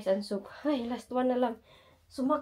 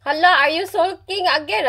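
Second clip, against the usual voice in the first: louder, higher teasing jab; teasing husband; 6 semitones higher, 9 dB louder than usual. Retold or imitated. Husband teasing his wife about sulking, louder. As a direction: needle her about sulking. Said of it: "Sabi niya".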